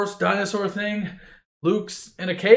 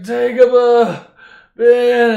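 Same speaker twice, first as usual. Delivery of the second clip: 1 drawn-out moan; 6 semitones higher, 10 dB louder, much slower than usual. Acted. Freezing Luke moans Dagobah.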